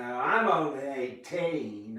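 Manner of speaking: loud, slow mock-childish whine; whiny loud protest. Deflecting the water question with a loud, drawn-out whine. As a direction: stretch it out like a sulky kid.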